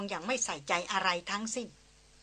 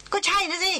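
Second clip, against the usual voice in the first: indignant retort; 10 semitones higher, 5 dB louder than usual. Acted. Christina insists indignantly.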